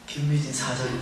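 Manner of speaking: low-pitched mock call of a title; teasing ghostly call. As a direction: say the name low, as a private running joke. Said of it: "옆에 국 찔러요".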